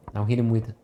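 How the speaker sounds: usual speaking voice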